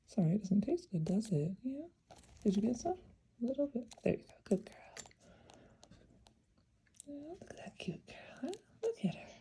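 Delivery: high, hushed cooing; soothing a pet. Real, not acted. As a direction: coo softly to calm her. Coaxing and praising the bird.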